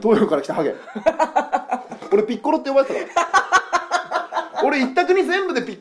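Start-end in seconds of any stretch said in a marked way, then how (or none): 0.8-2.1 high laugh. Real, not acted. Laughing about Piccolo nickname. 3.4-4.6 breathy laugh. Real, not acted.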